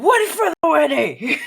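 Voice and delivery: loud, high blurted question; comic drunk non sequitur; random drunken outburst. Acted. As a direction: blurt it out of nowhere. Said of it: "He just says randomly".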